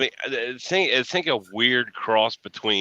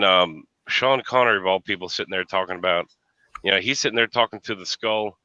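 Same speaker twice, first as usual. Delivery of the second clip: usual speaking voice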